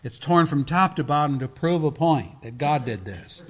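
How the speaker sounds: ordinary talk, nothing marked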